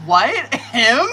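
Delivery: loud, laughing what, him; laughing disbelief. Retold or imitated. Reader stunned by his card, laughing loud disbelief. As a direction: laugh through the question in disbelief. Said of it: "I'm like".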